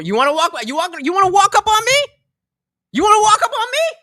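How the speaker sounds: rising, high loud dare; retold angry challenge. Dog walker to approaching stranger, high challenging shout. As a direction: shout it as a threat, pitch climbing.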